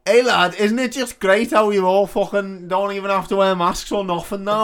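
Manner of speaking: loud, high drunken lad voice, hammed up; mock a pissed-up bloke shouting at you in the bogs. Drunk lad accosting in pub.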